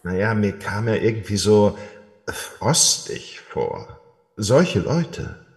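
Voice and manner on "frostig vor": low, slow, breathy drawn-out hiss on frostig; ominous frosty pun. Acted. Guard telling the princess why he refused the snowman, slow, low, spooky. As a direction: drag out the pun like an icy chill.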